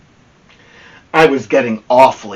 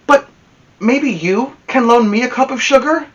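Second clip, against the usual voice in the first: sly plea; 10 semitones higher than usual. Acted. Wolf sly plea to listener.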